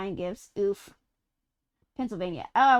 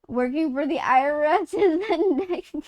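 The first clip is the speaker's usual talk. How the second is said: ordinary talk, nothing marked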